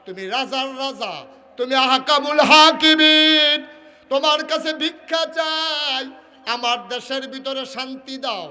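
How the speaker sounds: high, loud chanted cry, gradually easing; soaring plea with held endings; impassioned begging prayer. Acted. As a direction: call out to God at full voice.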